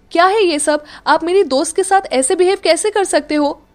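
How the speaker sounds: defending her friend; angry scolding. Acted. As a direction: tell your brother off hard. Nidhi scolds her brother.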